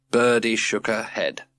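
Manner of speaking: plain reading voice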